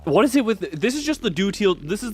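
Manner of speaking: ordinary delivery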